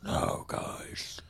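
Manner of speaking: raspy whispered demon. Pretend. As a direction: breathe it out like a demon. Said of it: "Or this one".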